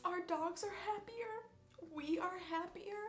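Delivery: high, tearful voice of happy crying. Real, not acted. Overjoyed about the dogs' new home, crying. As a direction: cry with thankfulness for the new home. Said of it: "I don't know why I'm crying".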